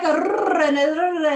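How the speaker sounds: exaggerated rolled R's as mock Welsh; attempted Welsh accent. Shown as a demonstration. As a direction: roll the "rrr" for a rough Welsh imitation. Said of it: "so I can, like".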